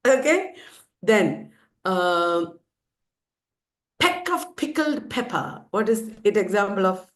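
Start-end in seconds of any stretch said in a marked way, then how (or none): 4.0-5.6 high "peck of pickled pepper", each p popped; repeated p sound. Shown as a demonstration. Teacher asks what it is example of, popped alliteration. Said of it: "pa sound is repeated".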